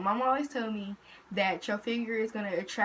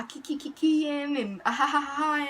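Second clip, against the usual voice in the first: higher, singsong fake giggle; mock giggling; 5 semitones higher than usual. Mocking her friend flirting with the dudes, fake giggly laughter.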